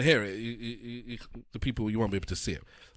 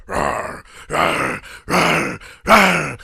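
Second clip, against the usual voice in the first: growing mock growl, rising higher and louder; 3 semitones higher, 8 dB louder, much slower than usual. Man chasing a woman down a dark street, growling. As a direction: growl at her like a beast, building up. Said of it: "he's chasing her and gone".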